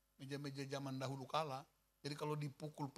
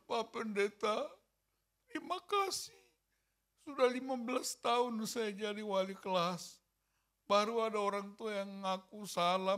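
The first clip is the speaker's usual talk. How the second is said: tearful thanks; 4 semitones higher, 4 dB louder than usual. Retold or imitated. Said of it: "Kan nangis".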